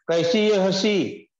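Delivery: "कैसी ये हंसी" तेज़ और ऊँची आवाज़ में, ताना मारते हुए; श्रोताओं को झकझोरते हुए ज़ोर से पूछो; ज़ोरदार फटकार भरा सवाल. Acted. Preacher asks rhetorically, scolding. (प्रवचन में हँसी पर ज़ोरदार ताना)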